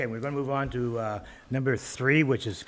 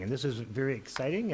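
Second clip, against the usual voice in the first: ordinary talk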